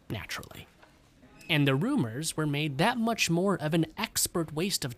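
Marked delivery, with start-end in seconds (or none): 0.1-0.7 a dry whispered aside. Acted. Narrator confiding the gossip was invented, whispered. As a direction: drop it in a knowing whisper.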